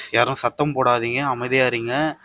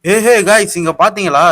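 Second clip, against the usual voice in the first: high, loud call to the group; excited call-over; 5 semitones higher, 6 dB louder than usual. Acted. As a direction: grab your friends' attention with a find. Calls friends over to a discovery. (உற்சாகமாக நண்பர்களை அழைக்கும் குரல்)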